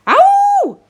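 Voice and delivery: very high, loud yelp; scream of pain. A narrator's reading. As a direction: yell out as if jabbed hard. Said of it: "he screamed so loudly it echoed".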